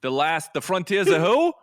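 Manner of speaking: put-on Boston accent. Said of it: "the Boston accent".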